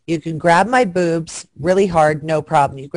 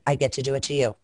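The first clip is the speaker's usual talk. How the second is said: ordinary delivery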